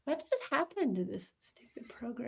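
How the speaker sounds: annoyed whisper at the computer. Real, not acted. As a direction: grumble it in a whisper. Cursing the program under breath.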